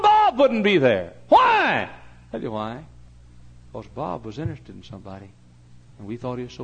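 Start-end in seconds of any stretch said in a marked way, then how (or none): 1.3-1.9 high, very loud question; preacher's shout. Real, not acted. Preacher calls out rhetorical question.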